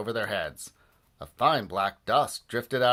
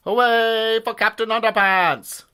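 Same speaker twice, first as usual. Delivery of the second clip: heroic shout; 11 semitones higher, 8 dB louder than usual. Acted. Hero's battle cry in story.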